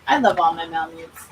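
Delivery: usual speaking voice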